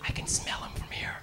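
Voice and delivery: hushed whispered aside. Acted. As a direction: whisper it behind his back. Passenger remarks behind his back.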